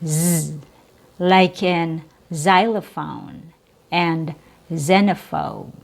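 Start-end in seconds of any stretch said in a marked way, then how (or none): 0.0-0.6 "z" as a buzzing z; z sound. Shown as a demonstration. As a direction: hold a soft z. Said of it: "pronounced with a Z sound".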